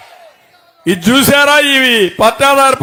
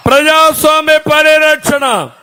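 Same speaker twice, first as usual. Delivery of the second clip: rally speech; 4 semitones higher than usual. Real, not acted. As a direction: rouse the crowd with every line. Rally speech calling the crowd. (Rally ప్రసంగం, గట్టిగా)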